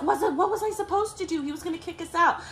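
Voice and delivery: high, fast defensive reply; defensive sister. Retold or imitated. Sister justifying calling the cops, high fast defensive. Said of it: "She's like".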